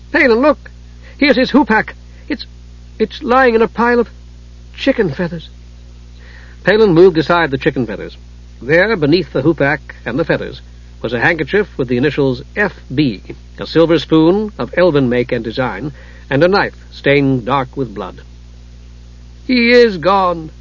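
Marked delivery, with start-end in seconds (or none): 0.1-5.5 high-pitched urgent discovery, halting midway; startled young woman. A narrator's reading. Usha finds the Kender's hoopak, high startled voice. 19.5-20.5 slow, high lament, lightly tearful; acted sob. A narrator's reading. Usha believes the Kender is gone, acted sobbing. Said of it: "Usha sobbed".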